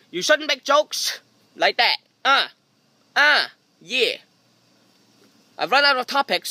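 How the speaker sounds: ordinary talk, nothing marked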